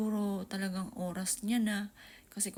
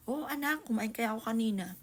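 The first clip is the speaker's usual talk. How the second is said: higher, brighter mock-casual reassurance, a bit louder; pretend breezy mother; 4 semitones higher, 6 dB louder than usual. Mother retelling her fib to the kids, mock-breezy. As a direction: brush it off lightly so they believe you. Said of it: "Pero char-char lang yun".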